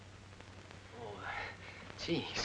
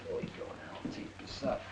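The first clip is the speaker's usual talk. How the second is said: soft unvoiced coaxing to an infant; whispering to a baby; 9 dB quieter than usual. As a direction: hush it to the little one. Feeding the baby fella.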